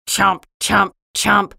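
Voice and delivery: short, punched munching sounds; chomping tree. Acted. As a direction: chomp out each word abruptly. Tree eating the piano.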